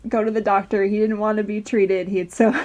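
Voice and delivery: ordinary talk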